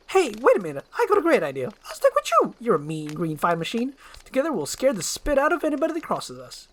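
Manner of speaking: a motor-mouth sidekick gushing praise; fast-talking eager donkey. Acted. Donkey pitches his idea.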